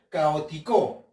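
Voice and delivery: "caótico" wrongly stressed as ca-o-ti-CÓ; stress on final CO. Shown as a demonstration. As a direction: push the last syllable "co" of "caótico". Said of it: "la sílaba tónica lleva en la co".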